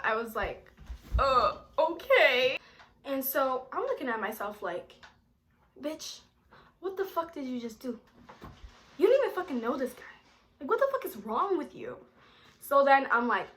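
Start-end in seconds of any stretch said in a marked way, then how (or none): 1.2-2.6 drawn-out, hesitant okay rising at the end; stall, then give in unsurely; reluctant agreement. Retold or imitated. Girl put on the spot by date's mom, hesitant. 5.8-12.0 hushed, then higher and quick; self-scolding. Retold or imitated. Girl scolding herself after agreeing, incredulous.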